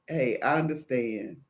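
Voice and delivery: low, soft reassurance; empathetic. Acted. Agent to a frustrated caller, low and understanding. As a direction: ease them down quietly.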